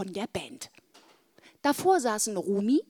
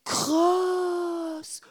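amazed whisper; 8 semitones higher than usual. A narrator's reading.

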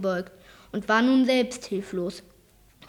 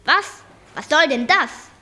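louder, indignant questioning; 5 semitones higher, 7 dB louder than usual. A narrator's reading. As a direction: snap back, starting high and loud. Angry owner challenges the children. (empört und laut nachgefragt)

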